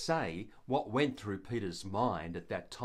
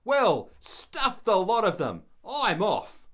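louder, higher exasperated grumble; fed-up outburst; 6 semitones higher, 7 dB louder than usual. Retold or imitated. Churchgoer hurt by gossip, storming off in exasperation. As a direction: blurt out your hurt and give up. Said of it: "And I have often thought".

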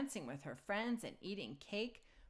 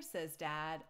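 ordinary delivery

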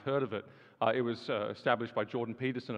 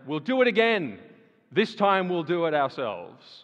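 mocking boast; 3 semitones higher, 7 dB louder, slower than usual. Mocks the proud people.

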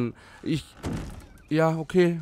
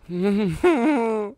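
nervöses Lachen; 8 semitones higher, 5 dB louder than usual. Real, not acted. Nervous laugh in horror game.

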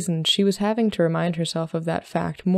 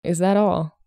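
sighed. A narrator's reading. Solomon answers Emmeline's confession, with a mild sigh. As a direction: ask it with understated resignation. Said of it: "Solomon sighed".

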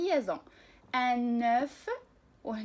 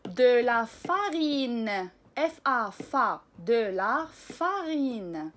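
slow reading; 4 dB louder, much slower than usual. Shown as a demonstration. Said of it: "لقراءة بعض الكلمات".

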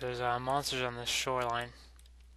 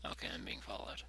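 whispering; 13 dB quieter than usual. Pretend. Whispered aside at nighttime.

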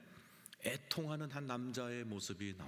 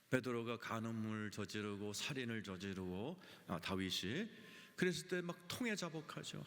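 ordinary talk

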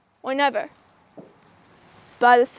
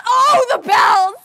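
loud, higher, drawn-out wail; anguished cry; 6 semitones higher, 5 dB louder, slower than usual. Acted. Reciter crying out at the bells' clamor, anguished wail.

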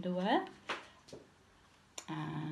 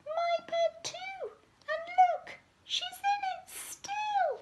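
extremely high squeaky cub; tiny squealing bear voice; Baby Bear. A narrator's reading. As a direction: squeak it out in shrieky alarm. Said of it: "Baby Bear squeaked".

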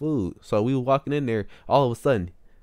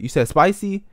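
loud, higher questioning yell; mock-yell it back to check the order; imitated yelling; 7 semitones higher, 7 dB louder than usual. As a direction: imitate a worker shouting a question. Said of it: "he was yelling".

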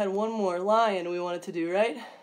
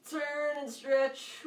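slow stretch cue; 5 semitones higher, much slower than usual. Shown as a demonstration. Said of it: "stretch cue".

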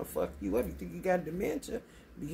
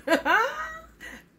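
high laugh; 5 dB louder than usual. Real, not acted. Laughs at her own joke.

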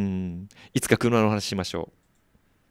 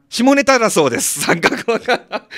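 high, loud, excited-sounding outburst; real loud voice; 12 semitones higher, 7 dB louder than usual. Real, not acted. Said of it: "大きい声で言いました". (大きい高い声で)

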